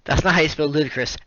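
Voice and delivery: usual speaking voice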